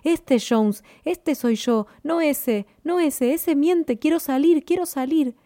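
high-pitched cry, climbing as it goes; an urgent plea to be let out, rising in pitch; desperate inner cry. A narrator's reading. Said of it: "la voz profunda y oculta en mí grita".

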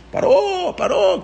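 very high, singled-out name calls; children calling out. Retold or imitated. Children at the window spotting Paró, high imitated calling out.

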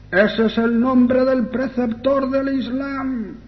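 high, drawn-out demonic wail; possessed girl's voice. Retold or imitated. Possessed girl answers the exorcist, in a high demonic voice. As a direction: stretch it out slowly, pitched far above normal. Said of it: "gritando con esa voz".

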